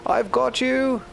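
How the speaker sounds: very high-pitched grandmother's cry; Grandma crying out. A narrator's reading. Grandma scooping Noi aboard, high cry. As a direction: cry it out high, as a rescuer would. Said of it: "cried Grandma".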